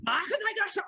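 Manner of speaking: high, shouted mock-German gibberish; imitating harsh German. Man imitates his date's changed tone, in mock German. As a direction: mimic a burst of foreign German speech. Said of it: "even her tone of voice changed".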